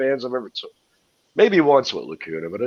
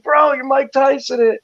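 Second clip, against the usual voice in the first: insistent fan; 14 semitones higher, 4 dB louder, slower than usual. Retold or imitated. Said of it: "I'm like".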